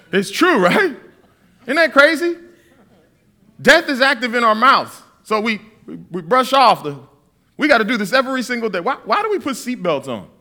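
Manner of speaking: ordinary talk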